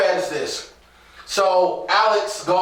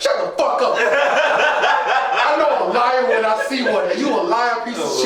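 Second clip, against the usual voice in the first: raised, comic telling-off; mock outrage; 4 semitones higher than usual. Co-host calling out a lying character, comically indignant. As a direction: berate him for laughs, pitch lifted. Mock-berating Wells for his lie.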